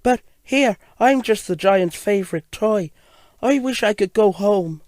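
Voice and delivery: high little engine longing for home; gold engine. Acted. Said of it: "she said".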